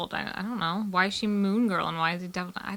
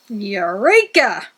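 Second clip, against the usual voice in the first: exclaiming; 9 dB louder, much slower than usual. Real, not acted. Reading the comic aloud.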